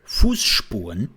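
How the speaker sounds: Fußspuren whispered slowly with lisped S sounds; a slow lisping demo; lisped word. Shown as a demonstration. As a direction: lisp through the S's of Fußspuren. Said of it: "as you can already hear in the word".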